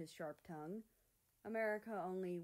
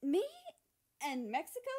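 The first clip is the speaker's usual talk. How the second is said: higher, louder incredulous voice; indignant character; 9 semitones higher, 9 dB louder than usual. Acted. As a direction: make it an offended squawk. America's indignant line.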